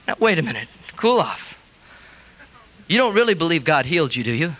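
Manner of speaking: a hint of cool skeptical friend. Doubting church friends brushing off the healing, skeptical.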